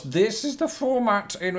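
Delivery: high, goofy put-on voice; silly voice. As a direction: pitch it way up like a silly character. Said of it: "I don't know why I did that voice".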